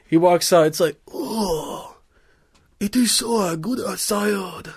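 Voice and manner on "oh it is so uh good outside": blissful villager. Acted. Villager voiced in story.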